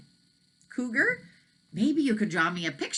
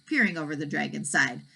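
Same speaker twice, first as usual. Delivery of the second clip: plain reading voice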